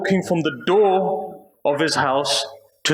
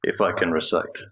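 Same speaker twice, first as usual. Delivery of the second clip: hushed aside; 13 semitones lower than usual. Real, not acted. Soft aside before the recitation.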